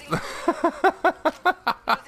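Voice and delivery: long helpless cackle; real high giggling fit; laughing hard. Real, not acted. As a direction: keep laughing, unable to stop. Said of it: "I don't know why I'm laughing so hard".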